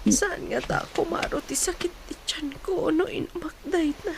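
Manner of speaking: whispered through stomach pain; barely voiced, hurting; weak whisper. Sick woman whispering about her stomach pain.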